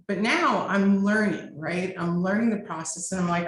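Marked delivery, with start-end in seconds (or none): none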